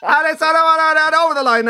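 high, loud mock finish-line call; race commentary. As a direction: imitate an excited commentator at the finish.

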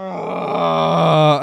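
long, loud, swelling "uhhh"; high, loud, stretched-out groan; drawn-out thinking groan. Real, not acted. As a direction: groan loudly while stuck for an answer. Stuck thinking of an answer.

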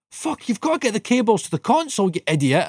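high, barking boss insult; mock furious boss. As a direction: snap at the helper like the boss did. Boss insults him, voiced.

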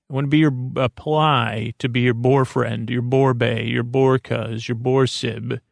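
ordinary delivery

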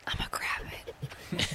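urgent hushed whisper. Pretend. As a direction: whisper so the fox won't hear. Sneaking up on a fox.

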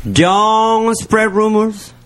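usual speaking voice

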